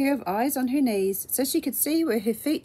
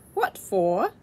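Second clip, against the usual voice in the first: ordinary delivery, nothing marked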